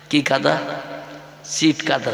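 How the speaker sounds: normal talking voice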